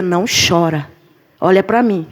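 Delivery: put-on a father holding his child's gaze, commanding; stern father. Father voiced, ordering calm. (pai severo, voz mais grave e firme)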